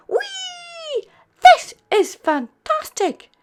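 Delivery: very high, loud whee; excited shout. A narrator's reading. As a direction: shout with joy downhill. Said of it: "shouted Steve".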